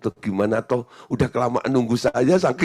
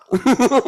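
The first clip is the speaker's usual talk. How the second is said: high, loud chuckle; real laugh; 12 semitones higher, 6 dB louder than usual. Real, not acted. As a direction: laugh at your own story. Laughs at own story.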